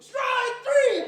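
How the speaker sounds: loud, high umpire shout; umpire call. Retold or imitated. Umpire calling strike three, loud and high. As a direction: bellow the call.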